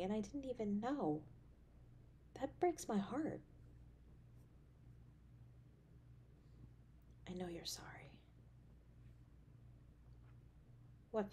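hushed, gentle voice, often near a whisper; soothing caring partner, hushed and close; soft tender comfort. Acted. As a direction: comfort a hurting partner quietly.

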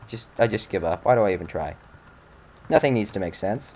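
usual speaking voice

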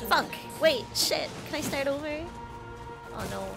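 ordinary delivery

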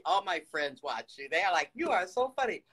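ordinary delivery

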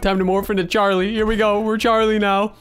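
ordinary talk

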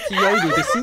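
mocking imitation. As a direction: throw the line back as a high laughing mock. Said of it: "今のはあかんやろ".

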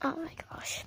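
breathless whisper. Acted. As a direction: whisper it so nobody hears. Sneaking, relieved alone.

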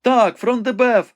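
high-pitched frightened maiden at the window; young woman Rebecca, anxious. A narrator's reading. Rebecca tells the wounded knight what she sees, high and anxious.

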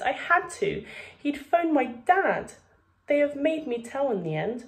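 put-on high, pleading young boy; upset boy. A narrator's reading. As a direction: plead like a distressed child.

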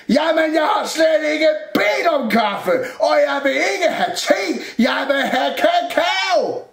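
high, loud insistent protest; exasperated shouting. A narrator's reading. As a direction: yell it, slowing for the final demand. Shouted quarrel over the order. (råber stædigt)

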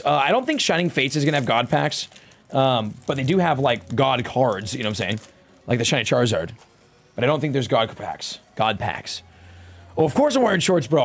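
ordinary talk

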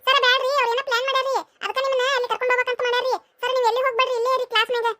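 high-pitched, hurried plea; urgent tattling. Acted. Classmate warns teacher of a scheme.

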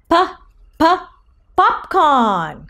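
"p-, p-" popped separately before "popcorn"; sounded-out first letter. Shown as a demonstration. Teacher suggesting a treat of popcorn, stuttered-out "p-" sounds before "popcorn". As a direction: pop the first sound twice, then the whole word "popcorn". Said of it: "P says".

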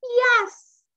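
high, loud, whooping yes; witch's cry. A narrator's reading. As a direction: cry out a delighted storybook yes. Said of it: "cried the witch".

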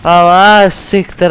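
loud angry cursing; angry shout. Real, not acted. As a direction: spit the curse out loud. Gamer raging at game.

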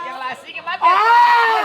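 slow, loud desperate plea; pleading shout. Acted. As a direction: beg her to stop, loudly. Pleading mother not to cry.

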